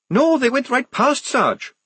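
high-pitched excited answer to a sergeant; urgent report. Acted. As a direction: report back keyed-up and high. Same excited scout reports back.

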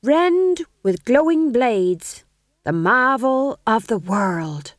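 slow, loud stage speech; grand declamation. A narrator's reading. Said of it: "Tom John said".

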